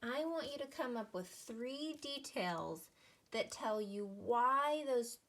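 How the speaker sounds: normal talking voice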